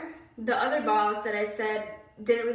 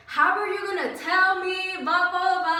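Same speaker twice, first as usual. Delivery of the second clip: raised, higher-pitched angry retort; retold yelling; 7 semitones higher, 5 dB louder than usual. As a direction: go off at the boss, loud and high. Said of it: "I was yelling at him".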